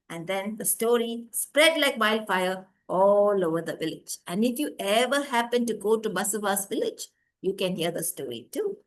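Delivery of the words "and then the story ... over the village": plain reading voice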